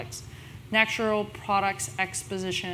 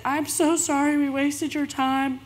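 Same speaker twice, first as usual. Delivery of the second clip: drama queening out; 6 semitones higher, 3 dB louder than usual. Retold or imitated. To the producer, mock-tearful drama queen apology. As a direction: overplay the heartbroken apology. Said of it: "I was totally drama queening out".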